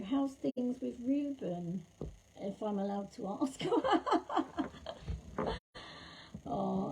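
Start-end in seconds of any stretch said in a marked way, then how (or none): 3.7-6.4 long high breathy giggle. Real, not acted. Medium teasing a sitter about Ruben, long giggle. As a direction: giggle at her own nosy question.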